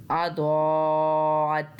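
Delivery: अदौअत with the दौ held very long; drawn-out अदौअत, stretching दौ; long madd. Shown as a demonstration. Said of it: "पाँच अलिफ जितना लंबा खींचेंगे". (अदौअत में दौ को पाँच अलिफ तक खींचें)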